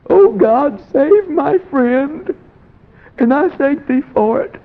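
high, loud begging prayer to God; pleading prayer. Retold or imitated. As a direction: cry out to God, begging for a friend. Preacher acting out Lenny's prayer.

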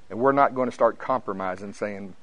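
usual speaking voice

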